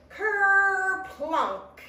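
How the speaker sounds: high, loud drawn-out "kerplunk"; falling thump. A narrator's reading. Tortoise falling from the tree, kerplunk noise. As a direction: stretch the first part high, then drop.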